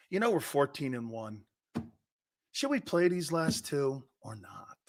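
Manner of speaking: lightly voiced smug coach; mock smug team. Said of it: "start talking like this".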